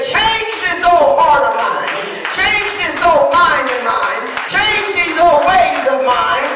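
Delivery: high, loud, slow shouted prayer; crying out to Jesus. Acted. Preacher calling upon Jesus for a changed heart, shouting slowly. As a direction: call on the name with everything. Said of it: "and say".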